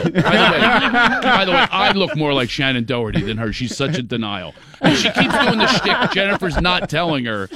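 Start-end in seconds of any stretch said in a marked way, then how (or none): 0.0-4.5 laughing. Real, not acted. Teller laughing through story.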